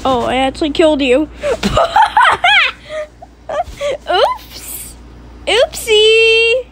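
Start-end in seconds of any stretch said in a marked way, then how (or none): 1.3-3.2 high, shrieky burst of laughter. Real, not acted. Child who actually killed sister in game, bursting laugh. 4.1-6.7 high, sing-out oopsie; mock-innocent oops. Real, not acted. Child who just killed sister in game, mock-innocent.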